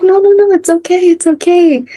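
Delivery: high-pitched, hurried-to-comfort retold voice; acting out a comforting friend; imitated reassurance. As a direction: voice a friend soothing someone, pitched well up. Friend reassures the crying speaker.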